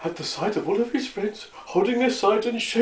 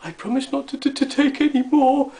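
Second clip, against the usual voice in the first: high, small pleading voice with a stutter; tearful stammer; 7 semitones higher, 3 dB louder than usual. A narrator's reading. Gnome promising Dame Tab to stop stealing, stammering tearfully. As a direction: promise pitifully, pitched up and halting. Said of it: "he stammered in between sobs".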